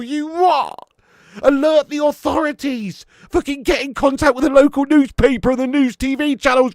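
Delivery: hammed-up disbelief at a rare goal; stunned excited shout. Real, not acted. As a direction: shout it like it's breaking news. Mock-disbelieving goal celebration.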